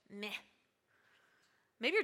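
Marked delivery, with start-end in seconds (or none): 0.1-0.3 apathetic meh. Shown as a demonstration. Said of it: "It's that just apathetic".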